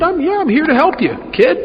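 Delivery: a hint of a kindly sponsor offering help; imitating Woody. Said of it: "He said, he said".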